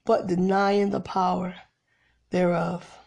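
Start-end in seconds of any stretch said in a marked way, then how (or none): none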